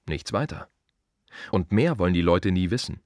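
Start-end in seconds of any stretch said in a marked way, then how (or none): none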